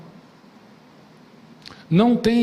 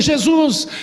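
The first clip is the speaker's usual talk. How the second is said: raised, higher pleading call over a crowd; desperate calling out; 6 semitones higher than usual. Retold or imitated. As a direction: call out to Jesus, begging for your son. Father calls out to Jesus.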